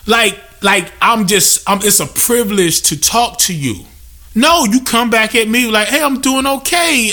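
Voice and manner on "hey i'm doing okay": mock polite answer, pitched higher; lightly acted courteous reply, higher voice. Showing a customer how to answer him, polite higher-pitched reply.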